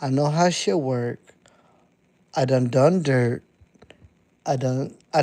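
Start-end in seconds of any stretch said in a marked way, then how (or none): none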